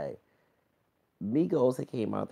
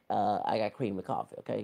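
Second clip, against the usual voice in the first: ordinary delivery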